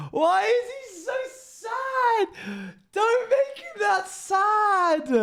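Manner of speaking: high, slow, hammed-up crying whine; mock sobbing wail. Streamer fake-wails over sad fan art of the frog, sung mock crying. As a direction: wail it like a fake sob song.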